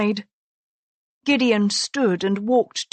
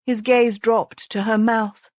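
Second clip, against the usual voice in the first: ordinary delivery, nothing marked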